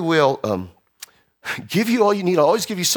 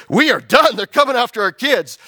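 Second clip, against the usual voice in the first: higher, urgent plea of a fed-up wife; acted desperation; 7 semitones higher, 3 dB louder than usual. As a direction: act out a mother at breaking point. Wife's desperate outburst retold.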